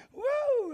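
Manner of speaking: high-pitched "woo"; sudden high cry of praise; preacher's whoop. Real, not acted. Preacher whoops mid-sermon.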